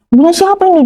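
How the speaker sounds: high-pitched mock yell without much volume; retold yelling. Mother demanding to know whose it is, mock yelling. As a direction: imitate an angry mother's cry, pitched high. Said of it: "Dia teriak-teriak".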